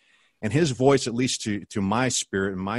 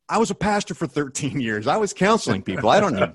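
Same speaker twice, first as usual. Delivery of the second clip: higher, louder indignant self-defence; defiant retort; 4 semitones higher, 5 dB louder than usual. Retold or imitated. Brushing off the friend's advice with indignant pride. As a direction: push back, proud and stubborn. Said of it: "I'm sitting there going".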